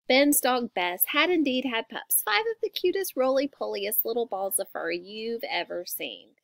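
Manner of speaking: ordinary delivery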